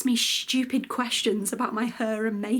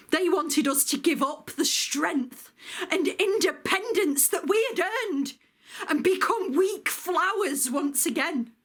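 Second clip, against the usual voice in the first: high-pitched, swooping outrage; impassioned indignation; 6 semitones higher than usual. Acted. Woman veteran refusing to give up hard-won independence, impassioned indignation. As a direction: protest it with pitch riding high. Veteran resents being pushed back to domesticity.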